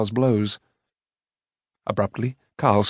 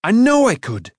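high, loud insistence; protesting; 11 semitones higher, 6 dB louder than usual. A narrator's reading. Boy sure of his sword-making, protesting loudly. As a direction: insist hotly, pitch leaping up. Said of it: "Taran protested".

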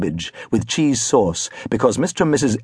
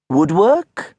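high, disbelieving teacher's voice; carpentry teacher's scoffing question; scornful carpentry teacher; 6 semitones higher than usual. A narrator's reading. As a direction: sneer the one word like a fed-up carpenter. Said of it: "the carpentry teacher had written".

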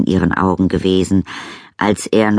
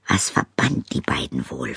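breathy whisper, inner thought; 3 semitones lower, 7 dB quieter than usual. A narrator's reading. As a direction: whisper it to yourself. His unspoken thought.

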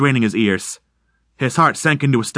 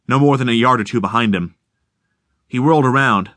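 ordinary delivery, nothing marked

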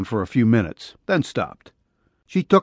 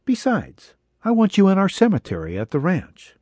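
put-on much higher young woman's voice, pleading; wife to husband, higher pleading voice; her voice; 7 semitones higher than usual.

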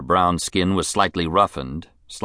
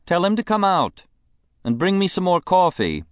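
high, languid society woman; a spoiled, bored rich lady; rich invalid wife; 12 semitones higher than usual. Acted. Mrs Sampson voice.

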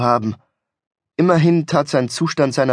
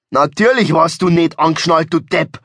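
higher, punchy scolding; exasperated retort; 4 semitones higher, 4 dB louder than usual. Acted. Driver scolds his unbelted passenger, exasperated. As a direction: hit each word in irritation. Driver snaps at friend's excuse.

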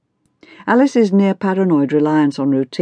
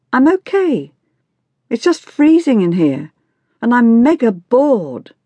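put-on higher, exasperated teen voice; bored teenager; 8 semitones higher, 4 dB louder than usual. A narrator's reading. Teenage daughter grumbles to her mother in the shop, bored and exasperated. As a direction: complain like a fed-up teenage girl.

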